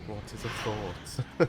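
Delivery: slow eerie voice. Retold or imitated. Quoting game AI voice.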